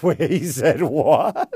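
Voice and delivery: loud, drawn-out question breaking into laughter; mock disbelief. HR hearing the complaint, loud laughing disbelief. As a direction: play amused disbelief, slow and loud.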